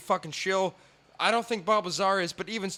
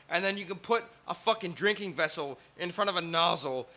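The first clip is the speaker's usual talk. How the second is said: ordinary talk, nothing marked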